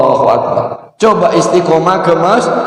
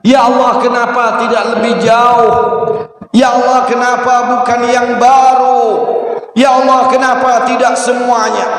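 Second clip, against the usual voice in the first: high, raised series of cries; repeated cries; 6 semitones higher than usual. Retold or imitated. Wife repeating all three of husband's cries, high. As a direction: call each one up to heaven. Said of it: "bojoku bengok-bengok itu tadi".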